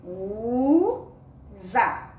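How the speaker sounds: USA as a long U, pause, ZA; syllable by syllable. Shown as a demonstration. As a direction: sound out USA for beginning readers. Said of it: "depois você lê o za".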